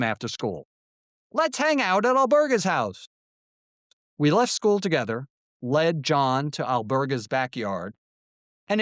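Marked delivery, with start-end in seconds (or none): 1.3-3.1 fake friendly invite. Boys luring John with fake friendliness.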